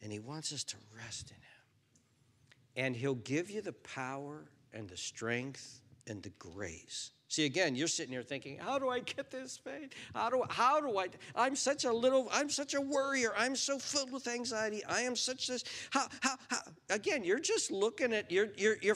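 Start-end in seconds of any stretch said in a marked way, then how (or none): 0.0-2.8 slow whisper. Real, not acted. Reassuring congregation. 8.6-16.6 high, louder, fretful pleading; anxious worrier. Retold or imitated. Preacher imitating an anxious believer, high fretting.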